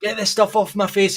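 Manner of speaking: high mock complaint of a little kid; upset child. Six-year-old to mum about sore face plaster, mock upset plea. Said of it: "and I was like".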